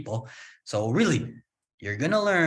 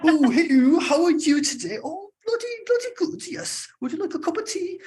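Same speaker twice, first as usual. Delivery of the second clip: hammed-up posh British accent, much higher and louder; mock posh British accent; 5 dB louder than usual. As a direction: parody of a stuffy upper-class English accent. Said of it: "so it sounds like they have a stick up their ass".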